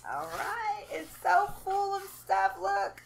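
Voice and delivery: high, thrilled unboxing voice, slowing at the end; excited. Real, not acted. Mom opens her giveaway box for viewers, thrilled. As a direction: squeal with joy over the full box. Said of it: "I'm so excited".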